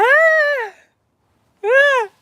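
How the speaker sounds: high, loud panicked scream; hammed-up shriek of terror; retold scream. Seeing the spider on herself, screaming.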